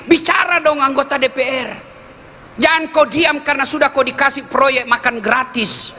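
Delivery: preaching at a rousing pitch; urging shout. Real, not acted. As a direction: call out to lawmakers to speak up. Preacher urging parliament members to speak.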